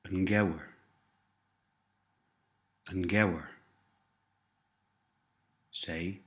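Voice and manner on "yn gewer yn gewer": gewer starting with a voiced G, not a K; soft G in gewer. Shown as a demonstration. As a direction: model the softened G in gewer. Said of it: "softens the K sound ... to a G sound".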